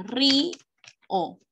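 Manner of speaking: "ri, o" as two syllables with a clear pause; separated syllables. Shown as a demonstration. Teacher splitting a word for pupils, syllables kept apart. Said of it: "Si dice que son separadas".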